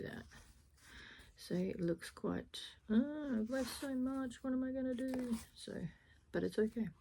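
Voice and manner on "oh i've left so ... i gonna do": higher, fretful mock worry; mock panic. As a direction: play a flustered crafter panicking. Mock panic over fur gap.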